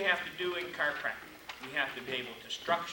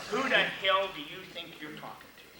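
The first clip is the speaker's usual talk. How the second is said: louder, half-joking indignant; mock indignation; 4 semitones higher, 5 dB louder than usual. Doctor scolding the young claims adjuster, mock angry. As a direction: blow up at her, hammed-up.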